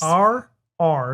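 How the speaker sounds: "our R's" with hard, sharp R sounds. Shown as a demonstration. Explaining how they say R, sharp R's. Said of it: "are very sharp".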